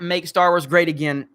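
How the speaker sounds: ordinary delivery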